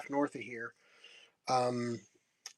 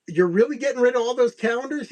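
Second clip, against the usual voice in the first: surprised; 7 semitones higher, faster than usual. Retold or imitated. Surprised question to vendor.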